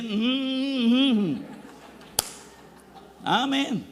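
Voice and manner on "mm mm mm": laughing through lazy mm hums; imitating someone who only answers mm; mock hums with a laugh. A preacher mocking an unclear answer, laughing hums. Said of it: "tendría que decir".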